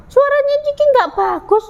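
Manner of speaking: high taunt from a small animal; mocking. Friends tease Ciki about the voice, high taunting. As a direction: jeer lightly in a high voice. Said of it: "Mengejek temannya seperti itu".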